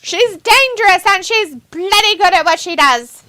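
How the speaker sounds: high, loud hammed-up Aussie voice; mock Aussie announcer voice; mock Australian accent. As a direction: parody her Australian accent, big and loud. Mocking wrestler's accent voice.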